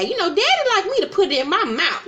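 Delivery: high-pitched imitation of a housewife; mock coy voice. Housewife telling the dinner table about her sex life, high mock voice. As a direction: put on a high voice, about to crack up. Voicing Heavenly's risqué answer at dinner.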